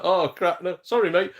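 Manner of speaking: high, loud mock-flustered apology. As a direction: act out a hasty, embarrassed sorry. Retold apology to the bouncer.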